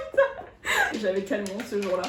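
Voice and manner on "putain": high and loud, laughing all through; barely able to speak for laughing. Real, not acted. Laughing hard as she tells her friend about her teeth.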